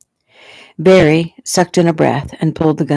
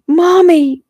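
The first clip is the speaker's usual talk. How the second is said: very high, loud cry for help; child's scream; 13 semitones higher, 5 dB louder than usual. A narrator's reading. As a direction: scream for a parent in terror. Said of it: "Jeffy screamed".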